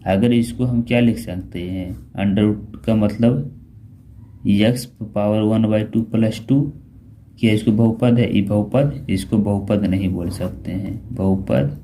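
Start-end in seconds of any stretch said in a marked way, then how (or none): 2.1-6.7 slow dictation. Shown as a demonstration. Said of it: "slowly spelling out the formula".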